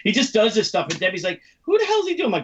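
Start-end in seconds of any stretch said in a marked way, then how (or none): 1.6-2.2 high-pitched baffled woman; Debbie. Retold or imitated. Woman puzzled by the son's impression, high and incredulous. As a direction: pitch it way up in mock bewilderment.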